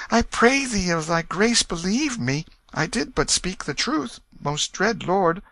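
high-pitched, earnest begging; pleading boy. A narrator's reading. Pauper Tom begging the king to spare him, high desperate plea. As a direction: beg in a high boyish voice. Tom begs for his life.

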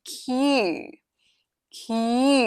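"key" said as kyoo, drawn out; k plus you. Shown as a demonstration. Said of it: "แล้วก็ you". (ออกเสียง "key" เป็น คิว ลากยาว)

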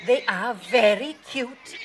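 high, slow, doting dog character; Pepper. A narrator's reading. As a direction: coo it loudly and draw it out. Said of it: "barked Pepper".

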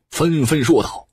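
ordinary delivery, nothing marked

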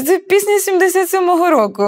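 high, loud insistence; insistent claim. Retold or imitated. Peter insisting the song is his, high and loud. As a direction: hammer home an outraged claim.

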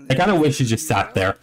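ordinary delivery

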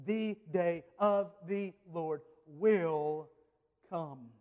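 "The day of the Lord will come", slow and evenly weighted, dropping on "come"; landing with a thud. Shown as a demonstration. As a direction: spell out each word, pause, then let "come" fall like a thud. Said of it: "I want you to hear that thud".

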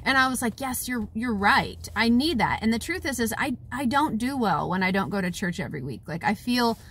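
ordinary delivery

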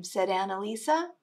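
usual speaking voice

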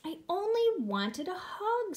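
high, slow hurt little bear; mildly tearful pout; sniffling. Acted. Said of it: "sniffed Douglas".

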